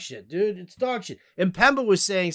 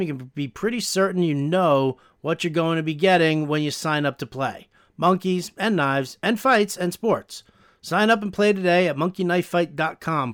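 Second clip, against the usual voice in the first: upbeat podcast ad read, a bit louder; sponsor ad read; 6 dB louder than usual. Acted. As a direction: sell it like a host-read ad. Reads sponsor ad.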